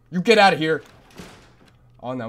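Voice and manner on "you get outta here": sudden shout. Real, not acted. Shooing off a monster.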